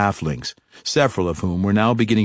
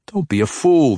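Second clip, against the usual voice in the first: a higher, louder scolding jab; chiding; 7 semitones higher, 4 dB louder than usual. A narrator's reading. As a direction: scold him like a careless pupil. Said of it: "the assassin chided".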